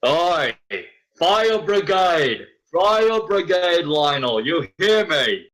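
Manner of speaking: high, slow shouts up to the tower; calling up. Acted. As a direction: bellow up at the bell tower.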